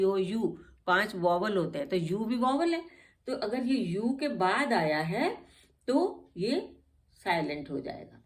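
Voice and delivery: normal talking voice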